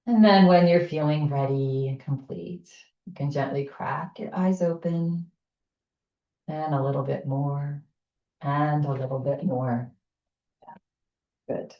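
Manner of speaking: calm, unhurried instructor voice leading a breath exercise; guided breathing. Acted. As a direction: settle the group into posture gently. Brings them out of exercise.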